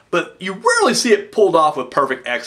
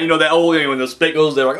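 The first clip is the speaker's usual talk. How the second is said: ordinary delivery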